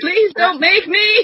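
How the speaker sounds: high, slow whimpering plea; mock pleading. Victim begging the gunman, high mock whimper. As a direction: beg in a put-on tearful voice. Playing along as the terrified victim.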